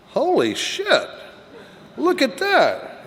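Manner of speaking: high, drawn-out mock astonishment; amazed outburst. Sponsor suddenly spotting something new in the book, acted amazement.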